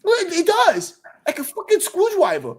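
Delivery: put-on a five-year-old holding her ground; insistent little girl. Daughter insists, imitated.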